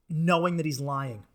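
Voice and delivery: ordinary delivery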